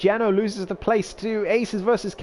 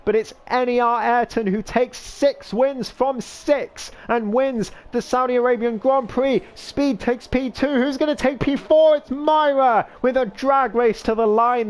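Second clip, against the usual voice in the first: excited finish call; 4 semitones higher than usual. Real, not acted. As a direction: call the checkered flag with rising excitement. Race finish called live.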